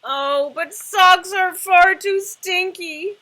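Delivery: very loud, high, slow disgusted shout. Acted. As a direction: bellow it in disgust. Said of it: "said the dragon with a shout".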